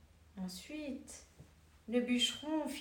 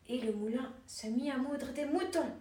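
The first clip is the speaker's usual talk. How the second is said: usual speaking voice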